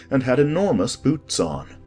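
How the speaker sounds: ordinary delivery, nothing marked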